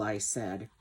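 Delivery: ordinary delivery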